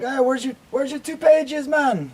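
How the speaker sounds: high-pitched imitated African accent; crazy African accent. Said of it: "they got this crazy African accent".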